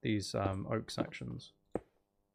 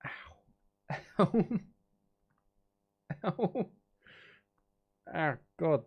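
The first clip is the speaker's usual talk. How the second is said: real pain yelp; 5 semitones higher than usual. Real, not acted. Sudden pain while streaming.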